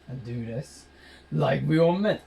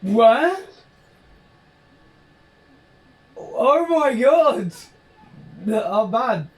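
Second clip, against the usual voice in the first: loud, high surprised outburst; shocked; 8 semitones higher, 6 dB louder, much slower than usual. Real, not acted. Stunned by what just happened, loud and high. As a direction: blurt it in disbelief.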